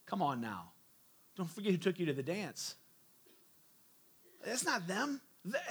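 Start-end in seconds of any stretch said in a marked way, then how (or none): 0.0-2.8 lightly voiced casual God, low and soft; a hint of a streetwise God nudging his people; mildly voiced God, low and easy; God's voice. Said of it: "God's a little bit like".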